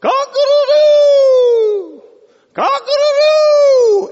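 piercing, very high, loud rooster crow. Acted. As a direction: crow full out, holding the last note. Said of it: "this rooster ... stretches out and goes".

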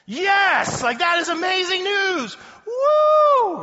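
very high, full-volume celebratory shouting; hammed-up ecstatic cheering; jubilant Zacharias. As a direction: whoop and yell like you just got incredible news. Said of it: "here's what I would imagine Zacharias would do".

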